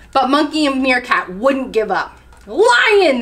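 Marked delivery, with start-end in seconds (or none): 2.5-3.2 loud, high alarm call; shouting. A narrator's reading. Monkey and meerkat scaring chameleon at lunch, shouting. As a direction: shout the warning out. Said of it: "they shouted".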